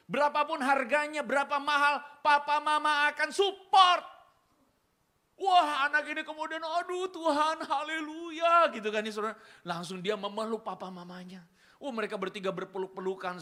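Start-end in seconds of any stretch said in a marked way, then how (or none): 0.1-4.1 louder, higher, slowing at the end; loud parental vow; emphatic parent promise. Retold or imitated. 6.6-8.7 much higher, louder, slow; hammed-up tearful praise; overjoyed daughter. Daughter overwhelmed with gratitude.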